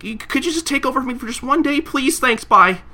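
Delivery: high, rushed pleading favor; pleading Hasegawa. Retold or imitated. Hasegawa begging Shinpachi to mind the store, high and pleading. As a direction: beg for the favor way up high, then hurry off. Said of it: "But he's like".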